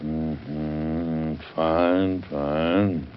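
husband half-listening to his wife, grunting replies; distracted grunting. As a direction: grunt along without really listening. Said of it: "you're grunting and not listening".

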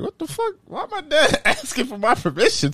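high-pitched incredulous reaction, breaking into a laugh; retold bewilderment, voice jumping way up; baffled disbelief. Son puzzled by dad asking permission, incredulous.

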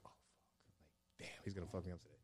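barely audible retold reaction; under his breath. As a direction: let it out very softly, almost a whisper.

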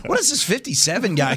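surprised. Retold or imitated. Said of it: "I kept being like".

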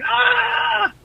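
drawn-out high mock shriek of panic; mock scream. Storyteller fleeing seals back to the boat, mock scream.

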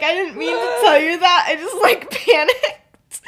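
high, loud, laughing fluster; mock freaking out. Boy who let his secret slip, mock freaking out through laughter. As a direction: protest in a comic panic. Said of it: "he's like, freaking out".

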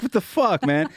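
high startled curse; stunned disbelief. Retold or imitated. Parents stunned that baby climbed back up. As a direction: blurt it out, stunned. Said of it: "We're like".

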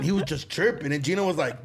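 ordinary talk

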